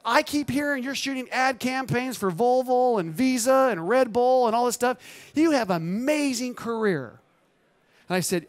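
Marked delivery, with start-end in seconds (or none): 0.1-7.2 raised pitch, acted admiration; retold gushing praise. Client praises photographer's career, gushing. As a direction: imitate an impressed admirer.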